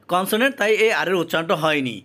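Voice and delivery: ordinary talk, nothing marked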